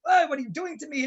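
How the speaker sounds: frustrated. Acted. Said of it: "Balak, all in frustration".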